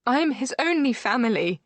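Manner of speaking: high, pleading wail. A narrator's reading. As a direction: cry it out in distress. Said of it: "she wails".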